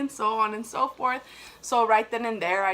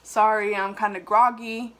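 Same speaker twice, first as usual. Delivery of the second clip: usual speaking voice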